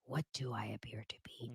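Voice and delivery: hushed, whispered musing on the question; mock-mysterious whisper. Echoes comment in mock mystery.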